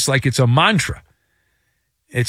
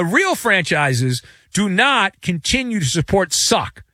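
usual speaking voice

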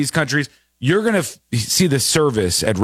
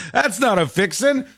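chuckling disbelief at the answer; host repeats the guest's line, laughing; 7 semitones higher than usual. Real, not acted. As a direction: say it through a laugh, amused.

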